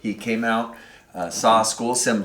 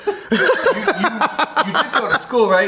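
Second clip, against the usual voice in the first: much higher and louder, a mocking teasing voice; 11 semitones higher, 10 dB louder, faster than usual. Host teases the guest about school, high mocking voice. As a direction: needle him in a put-on high voice.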